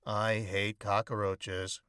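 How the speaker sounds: usual speaking voice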